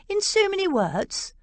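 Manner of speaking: high, sharp indignant question; mild mock indignation, pitched high. A narrator's reading. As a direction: flash back in outrage. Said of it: "George flashed indignantly".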